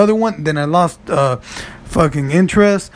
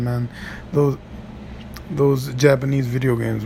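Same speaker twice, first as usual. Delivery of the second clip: normal talking voice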